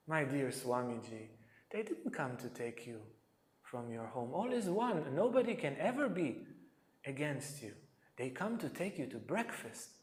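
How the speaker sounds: soft, hushed coaxing; gentle coaxing. Retold or imitated. Polly gently coaxing his master toward breakfast, soft and hushed. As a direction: talk him down gently and softly.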